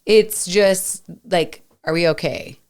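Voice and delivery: usual speaking voice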